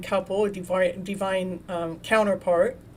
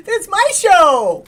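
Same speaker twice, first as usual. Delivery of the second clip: laughing mock diva; 9 semitones higher, 11 dB louder, slower than usual. Laughing after mock diva quote.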